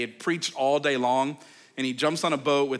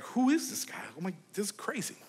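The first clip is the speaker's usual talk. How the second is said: amazed; 4 semitones higher, 7 dB quieter than usual. Retold or imitated. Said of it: "they're like".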